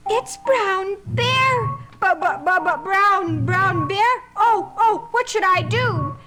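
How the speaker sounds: high, stammering mock fright; little bunny panicking at Brown Bear; mock panic. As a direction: stutter in a hammed-up panic.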